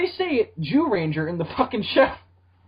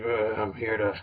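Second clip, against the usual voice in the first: Aquaman voice; 8 semitones lower than usual. Retold or imitated. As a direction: rumble it out like a dim sea god. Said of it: "That's how Aquaman's gonna talk".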